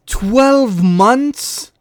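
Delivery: loud, high disbelieving question; can't believe what you just read; incredulous. Real, not acted. Repeats the ban in disbelief.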